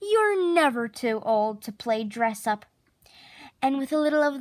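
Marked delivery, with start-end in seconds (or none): none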